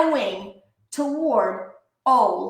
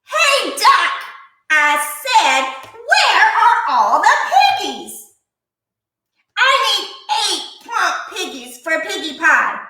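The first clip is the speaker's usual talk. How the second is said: high, loud bossy witch voice; demanding witch; 7 semitones higher, 3 dB louder than usual. A narrator's reading. Witch demanding the duck hand over pigs. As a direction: boss the duck around, witchy.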